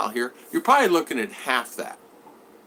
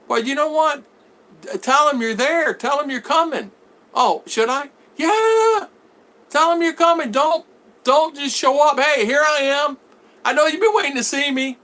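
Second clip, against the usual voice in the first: high, loud, insistent mock exchange; hammed-up pleading at raised volume; acted exasperated urging; 13 semitones higher, 4 dB louder than usual. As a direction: pitch it way up, exasperated, retelling an argument. Acted exchange, urging a visitor to announce.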